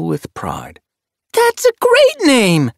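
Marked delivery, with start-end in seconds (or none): 1.3-2.7 very high, loud, stretched-out cheer; thrilled admiration. A narrator's reading. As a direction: exclaim it like a starstruck youngster. Young apprentice admires new name.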